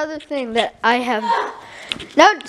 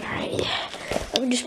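sneaky whisper; 4 semitones lower, 12 dB quieter, faster than usual. Real, not acted. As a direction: whisper so he won't hear. Sneaking up during prank.

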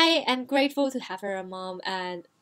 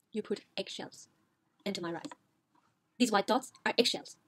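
stunned quiet; 5 semitones lower, 9 dB quieter than usual. Retold or imitated. Son's stunned reply to mom.

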